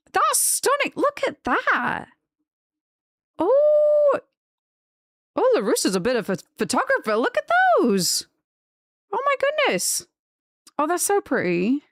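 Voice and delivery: high, delighted gasps of awe; gushing. Real, not acted. Streamer swooning over a viewer's photos, high and delighted.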